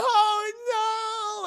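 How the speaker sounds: high, loud, drawn-out mock wail; imitating a distraught woman wailing; upset wail. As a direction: cry out in hammed-up anguish. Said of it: "she's so upset".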